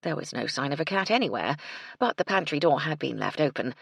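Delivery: ordinary delivery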